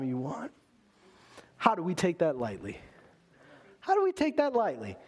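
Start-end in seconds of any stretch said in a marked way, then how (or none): 3.8-5.0 high-pitched, incredulous preacher's plea; impassioned challenge. Real, not acted. Preacher challenging the congregation over access to God, impassioned and high. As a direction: repeat the question much higher, astonished.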